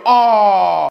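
high, loud groan of dismay; disappointed aw. Retold or imitated. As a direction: let out a crushed aw. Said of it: "You can hear me go".

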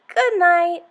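lightly voiced elephant; elephant saying good night. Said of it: "The elephant said".

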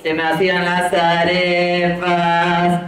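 drawn-out slow repeat of the phrase. Acted. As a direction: savor each word slowly. Repite la frase del público. (repetición lenta y saboreada)

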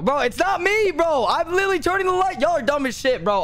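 high, indignant protest; raging gamer. Real, not acted. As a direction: pitch up, outraged at the accusation. Accused, protesting in game.

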